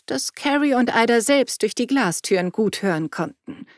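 usual speaking voice

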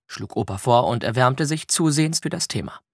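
plain reading voice

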